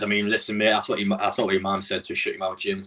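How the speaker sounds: ordinary talk, nothing marked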